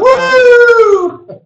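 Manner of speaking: very high, loud whoop; wild party whoop. Retold or imitated. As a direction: whoop like a reveller spraying champagne. Mimics the partying guy.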